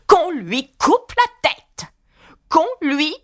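high, slow, drawn-out royal shriek; furious howl. A narrator's reading. Enraged queen orders the girl beheaded: furious howling. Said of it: "elle se mit à hurler".

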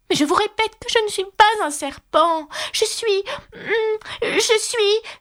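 put-on high little-girl voice, faltering at the end; high-voiced Alice losing her certainty; protesting little girl. A narrator's reading. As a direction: protest high, then grope slowly for words.

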